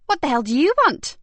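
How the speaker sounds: high, loud, suspicious challenge at the door; suspicious snap. A narrator's reading. Woman at the door confronting police, suspicious and loud. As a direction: demand it with a frown. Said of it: "she said with a suspicious frown".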